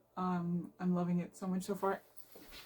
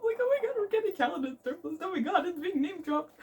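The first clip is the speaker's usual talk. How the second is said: excited fangirling; 8 semitones higher, faster than usual. Real, not acted. Fan gushes to viewers about a beloved name drop, high and rushed. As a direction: pitch leaps up, words tumbling out.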